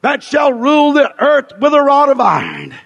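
fervent, driving revival sermon; fiery pulpit proclamation; full-force preaching. Real, not acted.

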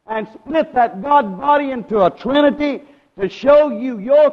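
preaching. Real, not acted. Preacher to congregation on the split God body, forceful preaching. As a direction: drive it out to the congregation.

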